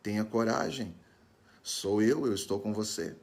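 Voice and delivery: ordinary talk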